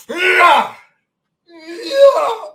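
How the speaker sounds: loud, high exasperated yells; frustrated outburst. Real, not acted. Speaker fed up with his own wasted time, exasperated yell. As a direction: let the frustration burst out.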